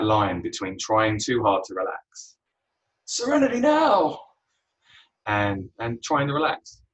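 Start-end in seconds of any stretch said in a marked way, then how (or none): 3.0-4.2 much higher and louder, a hammed-up shout; mock stressed yell. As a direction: blurt it out like the furious sitcom father. Callback to the yelled mantra.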